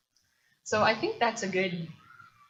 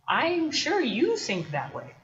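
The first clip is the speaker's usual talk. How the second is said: slow, higher, leaning on "you"; pointed concession; 6 semitones higher, 4 dB louder, slower than usual. Acted. Poet to knight about his wife, pointed concession. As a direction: humour them with a drawn-out "you". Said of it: "he says this line of".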